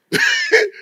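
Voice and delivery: sudden high laugh; high burst of laughter. Real, not acted. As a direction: laugh out at the joke. Laughs at own joke.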